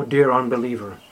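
ordinary talk

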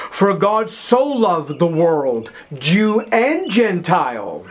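slow, weighty, every word set apart; slow solemn reading. Acted. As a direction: lay the verse down slowly. Famous verse read slowly.

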